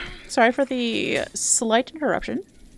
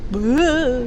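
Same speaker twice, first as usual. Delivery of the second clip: loud dumb bro grunt; frat bro; 5 dB louder than usual. Retold or imitated. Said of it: "frat guy voice line from this game".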